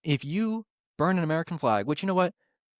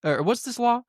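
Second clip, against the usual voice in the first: high, whiny mock of a clueless aide; 10 semitones higher than usual. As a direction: squeak it out like a little rat boy. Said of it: "he goes".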